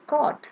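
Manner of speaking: "caught" said high and clear as a model; model word caught. Shown as a demonstration. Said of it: "it is pronounced as".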